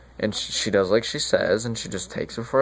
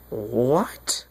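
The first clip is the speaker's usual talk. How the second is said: drawn-out rising what; retold bewildered shock; mock shock. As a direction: act out stunned disbelief, voice climbing. Juliet wakes to find Romeo dead.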